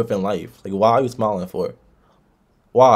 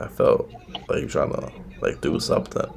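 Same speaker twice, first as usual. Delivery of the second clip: whisper; 8 semitones lower, 7 dB quieter than usual. Real, not acted. Awkward suggestive aside to stranger.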